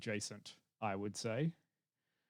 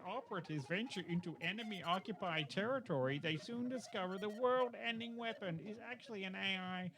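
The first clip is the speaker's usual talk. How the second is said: movie trailer narration; 5 semitones higher than usual. Acted. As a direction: ham up the synopsis like an epic film trailer. Reads synopsis in trailer voice.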